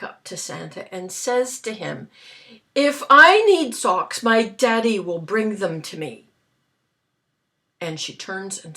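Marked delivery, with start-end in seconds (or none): 2.7-6.3 put-on defiant child retort.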